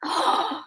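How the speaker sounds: quiet breathy gasp; soft gasp. Real, not acted. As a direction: gasp in surprise. Finds new note.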